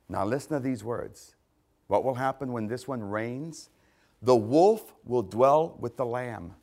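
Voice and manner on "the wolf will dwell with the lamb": slow, weighted verse with pauses; slow scripture reading. Real, not acted. Preacher telling listeners to listen to these words, slow and deliberate. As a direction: read it slowly, phrase by phrase. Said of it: "I'm gonna read them slowly".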